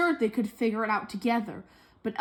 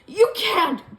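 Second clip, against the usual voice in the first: loud, higher gasped protest; breathless shocked cry; 5 semitones higher, 6 dB louder than usual. Acted. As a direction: cry out in shock as she freezes. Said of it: "gasped Anna".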